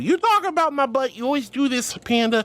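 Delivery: high, whiny playful scolding; mock accusation. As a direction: scold a repeat offender in play.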